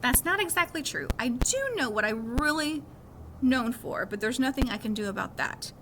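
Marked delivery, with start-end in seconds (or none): none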